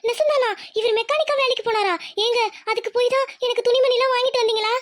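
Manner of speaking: high, stunned questions; wife learning her husband's secret work, shocked and high; shocked disbelief. Acted. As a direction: hear the news and can't believe it.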